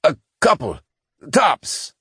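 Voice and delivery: high, clipped frustrated engineer. Acted. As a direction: grumble it in frustration. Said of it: "finished in a frustrated tone".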